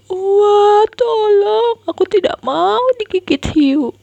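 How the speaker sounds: very high loud scared scream for help; terrified cry. Acted. Boy screaming to sister about shark, terrified. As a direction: shriek for help in panic.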